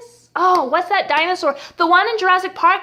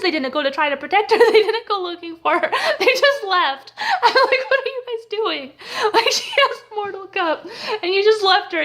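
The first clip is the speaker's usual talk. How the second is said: talking through real laughter; high, disbelieving giggly complaint; incredulous laughing; 5 semitones higher than usual. Real, not acted. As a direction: laugh helplessly while you protest. Laughs at the absurd abandonment.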